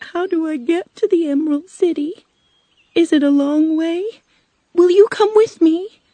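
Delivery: put-on high, questioning girl's voice; rising, urgent questions in a high pitch; anxious young Dorothy. Dorothy asks the witch the way to the city, anxious.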